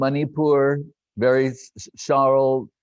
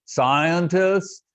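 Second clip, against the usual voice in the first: lightly imitated Prabhupada, higher, calling the word as a question; calling out Scientist; 5 semitones higher than usual. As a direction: call out the word Scientist like a summons. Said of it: "He'd go".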